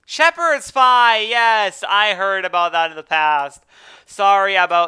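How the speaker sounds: high, loud, slowed-down exclamation; playful outraged disbelief at the name; loud mock surprise. Real, not acted. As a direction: shout the name in mock amazement. Reacting to the dish's English name.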